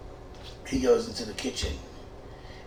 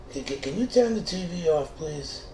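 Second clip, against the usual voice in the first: whiny roommate request; 8 semitones higher than usual. Retold or imitated. Roommate asks TV off.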